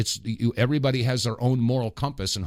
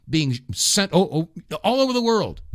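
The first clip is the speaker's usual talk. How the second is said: usual speaking voice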